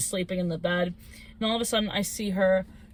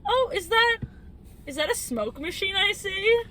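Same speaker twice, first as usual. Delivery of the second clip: higher-pitched play-acted marvelling; lightly acted awe, pitched up; mock wonder; 10 semitones higher than usual. Theatre fan spotting a smoke machine at haunted house, mock wonder. Acting out awed audience reaction.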